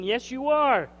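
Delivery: usual speaking voice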